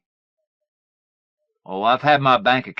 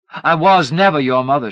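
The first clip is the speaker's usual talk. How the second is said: louder and higher, cutting; vehement; 5 semitones higher, 9 dB louder than usual. A narrator's reading. Mother disowning her son, loud and cutting. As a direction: let the anger pour out. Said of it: "she said".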